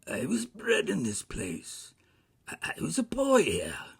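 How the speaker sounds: high, loud rush of recognition; excited wonder. A narrator's reading. Scrooge recognizing where he was a boy, excited cry. Scrooge amazed at boyhood place.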